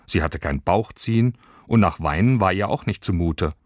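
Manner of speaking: ordinary delivery, nothing marked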